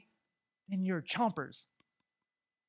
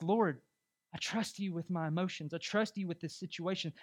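hushed, softer appeal to God; soft acted prayer; 6 dB quieter than usual. Preacher modeling a trusting prayer to the Lord, softly. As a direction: entrust it to God quietly.

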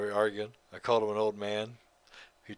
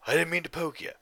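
retold angry quote; 8 semitones higher, 6 dB louder than usual. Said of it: "He's like".

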